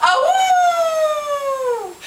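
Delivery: high, long sustained howl; wolf's soaring "ah-woo"; loud wolf howl. A narrator's reading. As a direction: howl loudly and hold it. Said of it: "howling loudly".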